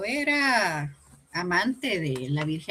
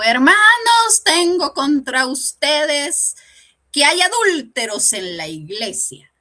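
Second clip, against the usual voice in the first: high, loud, slow pulpit scolding; preaching priest; 7 semitones higher, 8 dB louder, slower than usual. Retold or imitated. Priest warning the congregation about adulterers, slow loud preaching. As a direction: thunder it down like a stern old priest.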